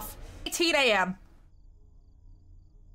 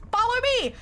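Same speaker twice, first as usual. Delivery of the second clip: urgent call; 12 semitones higher, faster than usual. Real, not acted. Calling out to Joe.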